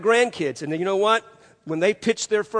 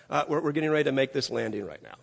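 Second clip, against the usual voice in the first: softer, fast, casual pilot talk; calm pilot; 6 semitones lower, 8 dB quieter, much faster than usual. Retold or imitated. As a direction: breeze through it like nothing's wrong. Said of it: "Just so calm".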